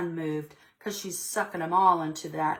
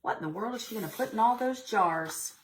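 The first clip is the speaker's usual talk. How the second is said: ordinary delivery, nothing marked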